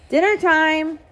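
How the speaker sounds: loud call to the family. A narrator's reading. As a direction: call it across the house. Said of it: "Dad called".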